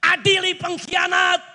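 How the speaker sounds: high, carrying chant, only mildly yelled; imitated protest cry. Preacher quoting crowds against traitors, mild mock yell. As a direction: call out the slogan as the protesters would. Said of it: "Orang lagi teriak".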